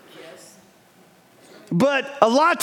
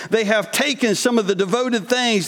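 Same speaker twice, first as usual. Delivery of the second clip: ordinary talk, nothing marked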